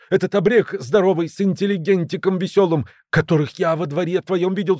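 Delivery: high, agitated burst of news; out of breath. A narrator's reading. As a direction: blurt it out as if just rushed in. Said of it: "запыхавшись, в комнату влетел Грыжа".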